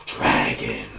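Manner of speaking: very low, dark rumble; deep dragon growl. A narrator's reading. Dramatic dragon announcement.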